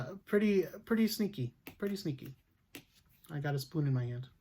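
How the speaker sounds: normal talking voice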